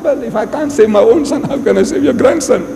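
God's excuse. Retold or imitated. God answering the plea for the grandson, protesting.

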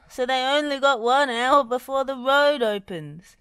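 high, loud worrying; agitated complaint; fretting. A narrator's reading. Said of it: "fretted Bob".

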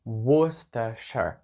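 Worcestershire, slow, as "wus-te-sher"; Worcestershire протяжно, по складах: вус-те-шер; careful pronunciation. Shown as a demonstration. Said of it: "це слово вимовляється як". (Worcestershire повільно, як «вустешер»)

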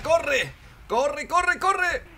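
high-pitched frantic cries; urgent, climbing yelps; panicked urging. Real, not acted. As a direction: urge a desperate escape, voice shooting up. Urging himself to run from artillery.

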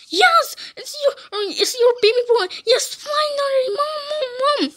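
high, excited cry to mom; pleading son. Pretend. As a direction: beg mom to recognise you. Son begs mother to recognise him.

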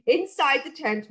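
ordinary delivery

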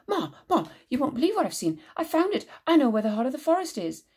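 high, eager calling out to his mother; Siddy bursting in with news; excited calling. Acted. Boy calling his mother, thrilled.

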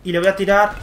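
ordinary talk, nothing marked

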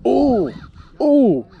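sharp high ooh of surprise; loud, high excited ooh. Real, not acted. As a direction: yelp at the big fish on the screen. Excited calls seeing big fish.

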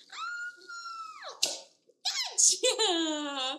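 ordinary delivery

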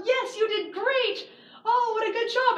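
praising mom. Retold or imitated. Mother praising her son.

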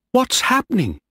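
very high, alarmed question; afraid. A narrator's reading. Young officer coming on deck to mutiny, frightened. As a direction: ask it in fright, pitched right up. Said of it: "He was afraid".

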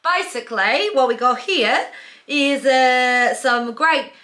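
put-on Australian accent. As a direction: play an Aussie shop host, hamming the Australian accent. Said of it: "tell me an accent, and I'll do this whole thing in it".

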